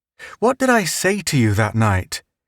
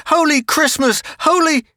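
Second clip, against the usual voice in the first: put-on boy yelping in the brawl; startled cry. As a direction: cry out in alarm. Child's startled outcry in fight.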